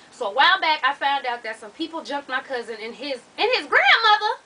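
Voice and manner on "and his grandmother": higher, louder, rising to incredulous; shocked disbelief. Real, not acted. Reader stunned that a grandmother got jumped, shocked disbelief. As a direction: blurt it as a stunned question. Shocked reaction while reading the letter.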